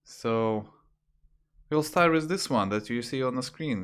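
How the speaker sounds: ordinary talk, nothing marked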